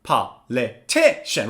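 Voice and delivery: "Politician" slowly split, TI strongest; slow four-beat "Politician" with TI stressed; stress on third syllable. Shown as a demonstration. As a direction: hit TI in "Politician", pausing between syllables. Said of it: "stress is on the third syllable".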